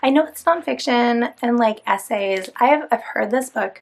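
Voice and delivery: ordinary talk, nothing marked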